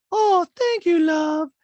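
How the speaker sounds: gushing, very high loud falsetto; hammed-up falsetto thanks; Diana impression. Diana receives a gift, in loud mock falsetto. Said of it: "And Diana goes".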